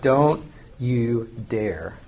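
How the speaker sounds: "Don't you dare" slow, each word set apart; low, deliberate "Don't ... you ... dare"; emphatic no. Shown as a demonstration. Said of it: "if you really want an emphatic no".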